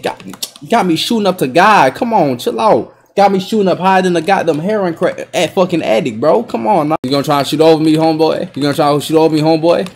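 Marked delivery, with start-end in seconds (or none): none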